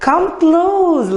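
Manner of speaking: high-pitched beckoning crocodile voice; coaxing crocodile. A narrator's reading. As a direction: lure the little one closer in a high voice. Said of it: "said the crocodile".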